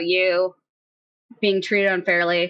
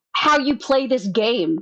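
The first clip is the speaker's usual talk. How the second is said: ordinary talk, nothing marked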